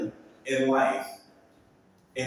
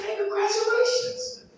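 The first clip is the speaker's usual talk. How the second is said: happy congratulations. Retold or imitated. Friend got GM job.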